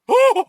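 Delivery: very high, loud mock gasp; hammed-up "Oh!" of shock. Co-host imitating the bartender when something shocking happens, high shocked gasp. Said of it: "gasps and drops a glass".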